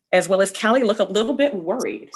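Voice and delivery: plain reading voice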